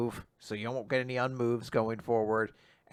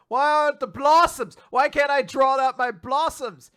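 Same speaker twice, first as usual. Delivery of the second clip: high, loud, alarmed whine; a villain who suddenly finds his power gone; panicked villain; 11 semitones higher, 7 dB louder than usual. Retold or imitated. Recapper voicing the villain panicking over lost blossoms.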